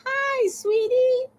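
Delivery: very high, drawn-out hello; affectionate high-pitched singsong greeting; sweet greeting. Real, not acted. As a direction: coo it high and slow. Greeting a viewer.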